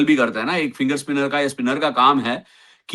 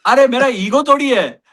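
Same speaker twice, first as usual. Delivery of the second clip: mock indignation; 8 semitones higher, 3 dB louder than usual. Mock protest to the audience.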